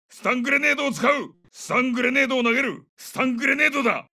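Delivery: urgent, high-pitched combat call; grenade callout. Acted. Soldier warns squad of stun grenade, urgent high callout.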